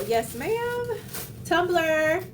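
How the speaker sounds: high, delighted cry over the best gift; excited squeal. Real, not acted. Unboxing a gift from Sequoia, high delighted squeal. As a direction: squeal it like the best present ever.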